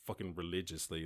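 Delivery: usual speaking voice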